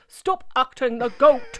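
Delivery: stern grandad. Retold or imitated. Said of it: "Bugger me lugs".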